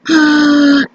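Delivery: a sharp, hammed-up gasp of horror; mock shocked gasp. Crafter realising her video ran too long, gasps in mock horror. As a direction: gasp like you just saw the clock.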